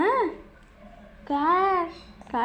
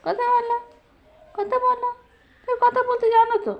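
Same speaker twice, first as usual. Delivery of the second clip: coaxing; 5 semitones higher than usual. Real, not acted. Parent begging baby to talk, high coaxing.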